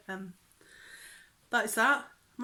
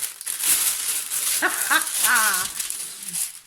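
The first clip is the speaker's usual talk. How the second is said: breathy, loud drawn-out laugh; long laugh; 5 dB louder than usual. Real, not acted. Vlogger getting her fabric out, long laugh.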